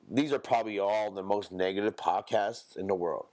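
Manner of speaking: normal talking voice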